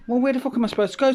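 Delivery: high, fast, indignant retort; exasperated outburst. Retold or imitated. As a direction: spit it out fast in disbelief. Retold outrage at council.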